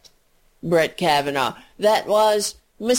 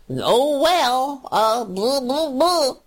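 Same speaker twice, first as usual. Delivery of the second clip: mock shrugging blah blah, pitched up a bit; mocking dismissive Democrats; 5 semitones higher, slower than usual. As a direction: brush it off in a lightly mocking imitation.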